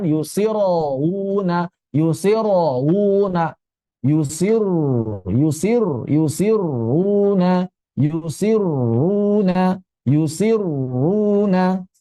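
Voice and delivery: long, slow yusiruna with a smooth, unbounced ra; slow tajwid demo of yusiruna. Shown as a demonstration. Teacher repeating yusiruna slowly as a model. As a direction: chant yusiruna slowly and let the ra flow. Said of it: "ingat jangan dipantulkan".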